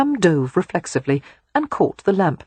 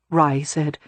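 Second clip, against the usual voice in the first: usual speaking voice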